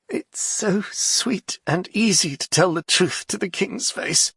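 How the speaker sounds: croaked. Acted. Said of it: "he croaked".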